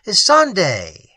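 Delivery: loud call of her name; crying out. A narrator's reading. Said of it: "I cried out".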